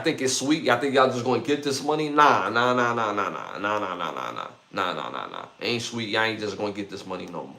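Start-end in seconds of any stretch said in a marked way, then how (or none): 2.1-5.5 fading nah nah. Real, not acted. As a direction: shake it off, trailing into a mutter. Dismissive nah chant fading out.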